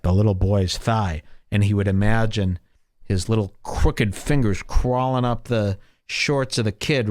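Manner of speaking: usual speaking voice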